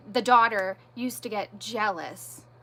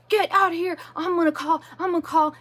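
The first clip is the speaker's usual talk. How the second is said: raised, higher mock yell, stumbling repeats; retold screaming; 6 semitones higher, 4 dB louder, faster than usual. Woman retelling her in-law's screamed threats, mock yell. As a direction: imitate a man yelling and stuttering threats, not full force. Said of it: "screaming at the top of his lungs".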